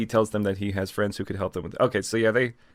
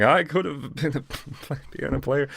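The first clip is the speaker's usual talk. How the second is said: slow, higher, laughing self-pitying whine; hammed-up sorry-for-myself sigh; mock self-pity; 5 semitones higher, slower than usual. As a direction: whine it like a wallowing loser. Mock self-pitying amputee quote.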